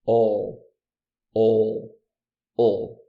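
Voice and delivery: loud, held "All" with the tongue pulled back; dark L. Shown as a demonstration. Teacher demonstrating the dark L in "All". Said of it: "Puxa pra trás".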